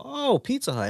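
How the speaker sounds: high, delighted "Oh, Pizza Hut"; light up at the box; happy surprise on seeing pizza; pleased surprise. Retold or imitated.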